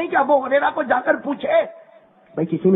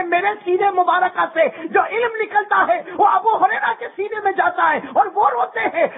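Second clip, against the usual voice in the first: preaching at full force; 9 semitones higher than usual. Acted. Preacher marvels at the weeping young scholar, full force. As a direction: lift the crowd with awe. Preacher building to the Prophet's wonder.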